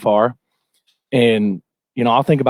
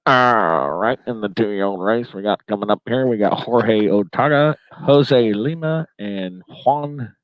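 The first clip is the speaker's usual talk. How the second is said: low, drawn-out track announcer call; slow announcer voice, dropping off at the end; race announcer impression; 4 semitones lower, slower than usual. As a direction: imitate a backyard race caller, stretching the names. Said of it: "and then he'd be like".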